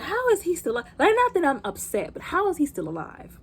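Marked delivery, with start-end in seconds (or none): 0.0-0.9 disbelief. Retold or imitated. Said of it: "I was like".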